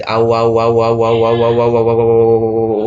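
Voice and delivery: quick repeated आ उ chant to show the merge; said fast. Shown as a demonstration. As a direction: rattle the vowels off quickly until they blur. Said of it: "ऐसे fast बोले".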